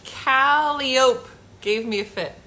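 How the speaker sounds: "Calliope" slowly as KAL-ee-ope, rhyming with rope; mispronounced calliope. Real, not acted. Host reading an unfamiliar word, "Calliope" mispronounced KAL-ee-ope. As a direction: drag out "Calliope" with a silent final e. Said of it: "I'm not saying it right".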